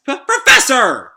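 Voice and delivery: stuttered high, loud cry; shocked shout. Acted. Character calling after the fleeing professor, shocked shout. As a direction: sputter then yell in alarm.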